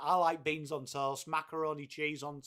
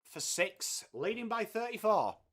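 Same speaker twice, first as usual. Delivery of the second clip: cricket commentary; 4 dB louder than usual. Acted. Calls the cricket live.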